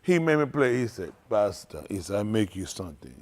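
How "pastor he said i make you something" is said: low, soft imitation of the cook; lightly voiced Jamaican man; a hint of a Jamaican accent.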